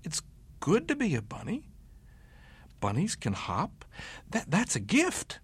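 high bunny voice, cheering up; happy bunny. A narrator's reading. Bunny cheering himself up, lightly high and glad. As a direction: say it with new hope.

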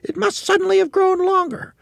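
very high, loud, squeaky wife voice; Missus Twit. A narrator's reading. Wife puzzling over her walking stick, high loud bewildered voice. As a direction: pitch it way up high, loud and baffled. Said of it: "Missus Twit said".

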